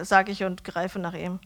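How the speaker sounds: plain reading voice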